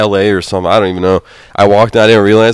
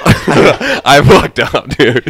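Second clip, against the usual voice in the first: slow, laughing admission; chuckling at his own mistake; 2 semitones higher, much slower than usual. Real, not acted. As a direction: laugh it out slowly. Laughing at own airport mishap.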